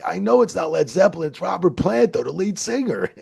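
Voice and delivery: loud, high-pitched retold protest; exasperated insistence. Wrestler correcting a doubting friend, exasperated. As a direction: insist at the top of your range.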